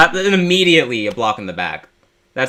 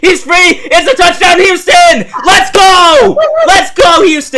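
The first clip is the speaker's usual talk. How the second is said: screaming touchdown call, very high and loud; 9 dB louder, slower than usual. Real, not acted. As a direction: explode with joy on the score. Calls touchdown live.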